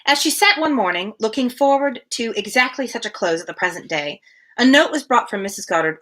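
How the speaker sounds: even, measured reading-aloud voice; steady literary narration; story narration. Acted. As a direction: read the classic novel aloud to a friend. Rereads passage after losing place.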